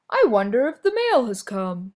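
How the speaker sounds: lightly voiced cheerful lion; a hint of a curious lion wondering. A narrator's reading. Dandelion speaks in story.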